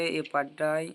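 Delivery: ordinary talk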